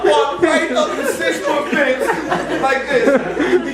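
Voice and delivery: slow, loud words broken by laughter; laughing disbelief. Real, not acted. Preacher telling the congregation about his dog's climb, laughing. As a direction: laugh through it, amazed at the dog.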